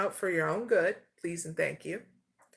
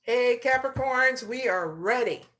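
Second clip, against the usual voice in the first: loud, higher-pitched opening call; 5 semitones higher, 6 dB louder than usual. Real, not acted. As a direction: hail the audience, announcing you are ready. Calls the viewers back to begin.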